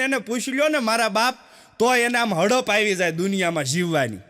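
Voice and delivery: usual speaking voice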